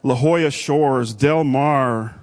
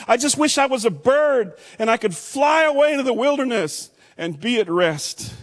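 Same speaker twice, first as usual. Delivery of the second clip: anguished plea; 9 semitones higher than usual. Acted. Preacher voicing David's anguished prayer.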